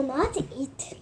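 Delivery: unvoiced whisper; whispered promise. Whispering a promise to spare Louis. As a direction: breathe it as a secret vow.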